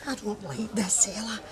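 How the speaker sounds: hushed, uneasy whisper; nervous whisper. Acted. Mary whispers her doubts to Ella, hushed. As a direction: whisper it so nobody overhears.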